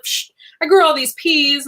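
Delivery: casual boast. Retold or imitated. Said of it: "He's just like".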